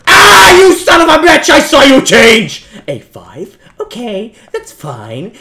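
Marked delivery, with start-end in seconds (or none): 0.1-2.7 high, loud furious yell; cheated, yelling at it; angry shout. Real, not acted. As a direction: rage at the game. Sign changes, he rages.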